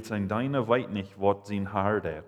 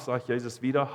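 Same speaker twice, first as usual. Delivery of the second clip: ordinary talk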